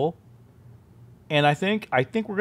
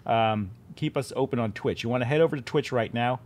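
normal talking voice

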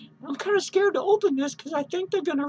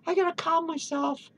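ordinary talk, nothing marked

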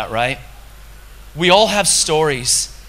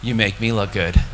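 God's reply; 9 semitones lower than usual. Retold or imitated. God answering the man in the park, low and assured. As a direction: answer deep and unhurried, as God. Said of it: "You know what he said to me?".

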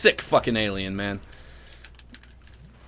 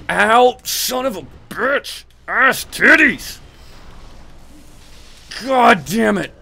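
high, loud, slow drawn-out curses; pained yelling; 11 semitones higher, 6 dB louder, slower than usual. Real, not acted. Gamer being hit hard, yelling high and drawn out. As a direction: howl in frustration at getting hit.

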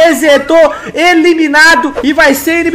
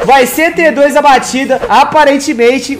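ordinary talk, nothing marked

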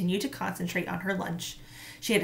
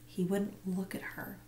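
whisper; 11 dB quieter than usual. A narrator's reading. Narration dropped to whisper.

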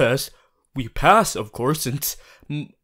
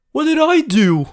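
high, loud surprised question; taken aback; 11 semitones higher, 5 dB louder than usual. Acted. Puzzled by her partner's sulk, a high surprised question. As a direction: blurt it out, caught off guard.

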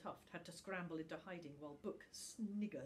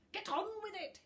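much higher, louder impatient urging; mock hiss; 9 dB louder, faster than usual. A narrator's reading. Book urges little Tuft impatiently, mock hiss. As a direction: push him to speak. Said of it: "hissed Book".

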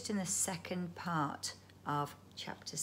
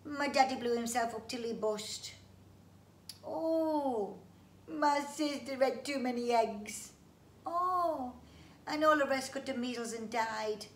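sad creature voice; 6 semitones higher, 7 dB louder than usual. Acted. Bungee sad family list.

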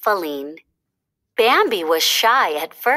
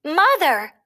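high, frightened call; lost fawn calling out; crying out; 8 semitones higher than usual. A narrator's reading. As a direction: cry out for mother. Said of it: "he cried".

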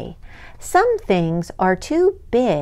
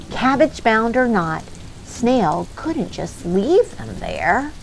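usual speaking voice

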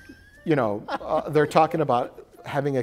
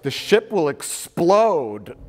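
drawn-out, louder, higher doom; slow dramatic warning; 6 semitones higher, 7 dB louder, much slower than usual. Retold or imitated. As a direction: announce the doom slowly. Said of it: "Ro's like".

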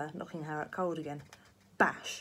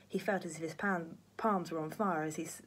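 ordinary delivery

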